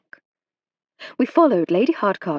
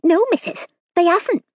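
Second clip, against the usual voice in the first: high, louder servant voice with a lisp; lisping toothless maid; 11 semitones higher, 5 dB louder than usual. A narrator's reading. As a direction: lisp it through missing teeth. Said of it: "lisped Hilda through her missing teeth".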